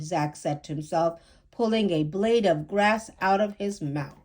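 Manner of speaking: ordinary delivery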